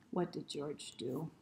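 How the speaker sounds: usual speaking voice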